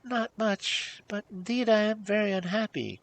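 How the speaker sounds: high, unhappy princess voice; tearful princess. A narrator's reading. Princess confesses unhappiness to old dame, tearfully. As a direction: lament as if near tears. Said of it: "bursting into tears".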